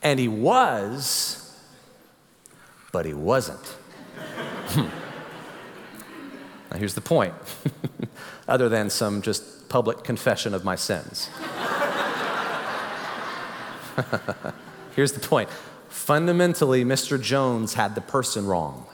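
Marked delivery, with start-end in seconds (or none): 4.2-5.2 breathless laugh. Real, not acted. Laughing at own prank story. 11.3-14.5 breathless laugh. Real, not acted. Laughing at own prank story.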